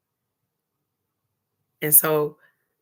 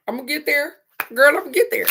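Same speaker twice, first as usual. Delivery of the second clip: higher and louder, building resolve; determined cheer; 8 semitones higher, 5 dB louder, much faster than usual. Real, not acted. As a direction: hype yourself up. Determined self-encouragement.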